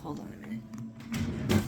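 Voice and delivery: slow breathy whisper; whispered aside. Real, not acted. Speaker to listener, a whispered hold on. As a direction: murmurs it while busy. Pauses story for a task.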